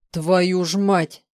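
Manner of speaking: loud, slow, drawn-out swearing; shocked curse. A narrator's reading. Man hearing shock news, loud slow cursing. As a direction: let the curse out with full weight.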